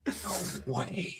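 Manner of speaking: high, very soft mock disbelief; hushed amazement. Friend reacts to hearing she's a brass, hushed amazement. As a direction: imitate a friend's hushed astonishment, pitched high.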